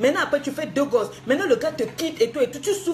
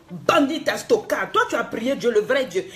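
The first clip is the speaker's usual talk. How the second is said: ordinary talk, nothing marked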